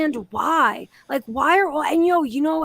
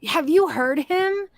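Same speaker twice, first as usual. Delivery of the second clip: usual speaking voice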